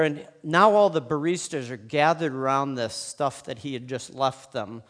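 ordinary talk, nothing marked

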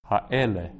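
Ha'ele with the middle E stressed; stress on second-last syllable. Shown as a demonstration. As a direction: lean on E in Ha'ele, then fall away. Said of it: "which syllable you put the stress on".

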